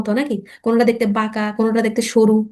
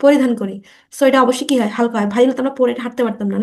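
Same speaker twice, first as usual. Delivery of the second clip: ordinary delivery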